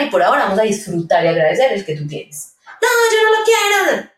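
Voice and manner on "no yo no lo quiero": put-on much higher pouty child voice; imitating sulky child. Expert voicing a sulky child refusing a toy, much higher.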